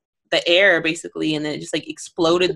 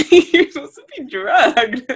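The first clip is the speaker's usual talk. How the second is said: higher voice, breaking up with laughter; laughing disbelief; 6 semitones higher, 3 dB louder than usual. Real, not acted. Host mocking the drugged mom waking quickly, laughing. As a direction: laugh through the protest.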